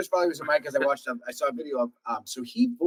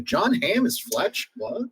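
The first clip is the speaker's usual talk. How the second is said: incredulous; 8 semitones higher, much slower than usual. Retold or imitated. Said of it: "I was like".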